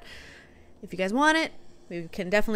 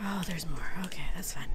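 whispered to self; whispering; 3 semitones lower, 8 dB quieter, faster than usual. Real, not acted. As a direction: breathe the words to yourself. Quiet muttering during fight.

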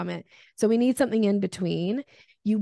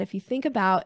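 normal talking voice

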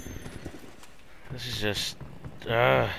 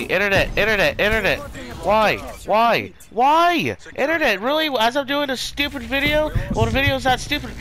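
exasperated rant; 11 semitones higher than usual. Real, not acted. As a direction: beg the internet in rage. Pleading rage at internet.

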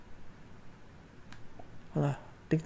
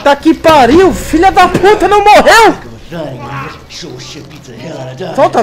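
screaming; 7 semitones higher, 10 dB louder, slower than usual. Real, not acted. Jump scare in horror game.

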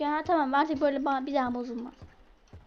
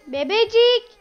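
put-on higher loud call to baby; 6 semitones higher, 8 dB louder, slower than usual. Calling the baby again higher.

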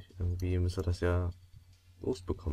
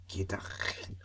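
whisper; 8 dB quieter, much slower than usual. Real, not acted. Whispering during surgery game.

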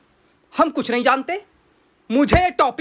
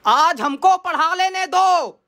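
exasperated outburst; 3 semitones higher than usual. Acted. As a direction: plead and fume dramatically at being interrupted. Teacher's exasperated outburst at interruptions.

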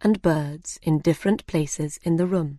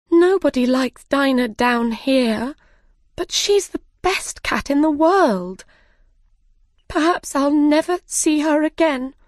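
high, louder near-sobbing lament; tearful Alice; 8 semitones higher, 3 dB louder than usual. Acted. Alice mourns her cat alone, high and tearful. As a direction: plead it out, close to tears. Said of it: "started to cry again".

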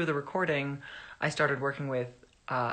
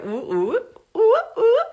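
ooh cry; 10 dB louder than usual. Real, not acted. Reacting to a fan's ooh request.

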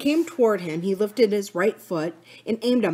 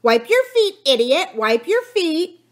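high, loud squawking; screeching parrot; 10 semitones higher, 6 dB louder than usual. A narrator's reading. Kiki the parrot heard by two men, screeching. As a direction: scold shrilly like a parrot. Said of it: "Kiki's voice came again".

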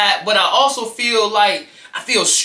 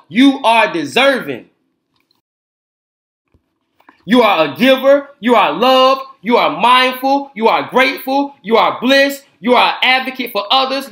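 steady, upbeat read-aloud of affirmations; reading affirmations to children; 2 semitones higher, 4 dB louder than usual. A narrator's reading. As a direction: read each affirmation warmly and clearly.

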